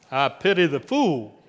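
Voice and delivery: a loud tough-guy catchphrase bark; Mister T impression. Preacher quoting a TV tagline for the congregation, loud tough-guy voice. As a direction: growl it out like a tough TV hardman. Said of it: "even if you were Mister T".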